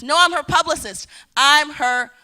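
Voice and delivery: loud, insistent declaration; loud and emphatic, slowing on the last line; excited, frustrated. Retold or imitated. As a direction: punch it out proudly, a bit fed up. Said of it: "I say that with excitement and frustration".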